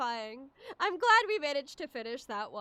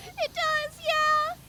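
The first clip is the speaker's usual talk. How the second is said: excited; 8 dB louder, much slower than usual. Real, not acted. Excited agreement.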